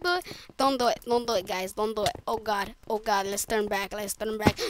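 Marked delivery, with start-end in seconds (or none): none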